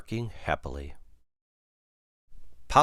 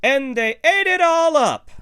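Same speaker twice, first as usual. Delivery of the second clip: very high, loud squeaky cub wail; piercing, squeaky cub protest; Baby Bear; 9 dB louder than usual. A narrator's reading. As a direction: squeal the complaint as a little bear. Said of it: "in her high, squeaky voice".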